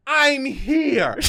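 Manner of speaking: drawn-out, heartfelt announcement; earnest proclamation. Pretend. Dreamer proclaiming from European rooftops, earnest proclamation. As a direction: declare it with mock-sincere wonder. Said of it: "I would say it unironically".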